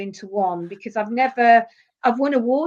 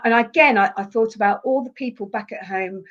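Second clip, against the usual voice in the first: usual speaking voice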